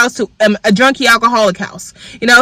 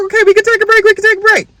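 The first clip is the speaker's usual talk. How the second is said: imitated crying; 11 semitones higher, much faster than usual. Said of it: "then he starts to cry too".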